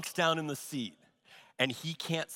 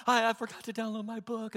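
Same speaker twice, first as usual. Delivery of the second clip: mock whine; 6 semitones higher, faster than usual. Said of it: "she wouldn't give it up about".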